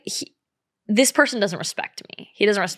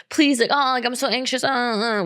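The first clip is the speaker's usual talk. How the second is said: higher-pitched mock whining; whiny; 5 semitones higher than usual. Man calling her during his little episode, imitated as whiny. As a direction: imitate him pleading anxiously in a whine. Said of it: "whatever, whiny".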